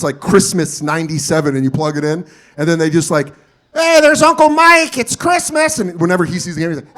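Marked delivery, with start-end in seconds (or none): 3.7-5.8 high, loud stilted announcing; home-movie amateur. Retold or imitated. Relative filming Christmas ninety-seven, clueless and loud. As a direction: announce it awkwardly to the camcorder. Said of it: "No one knew how to be on camera".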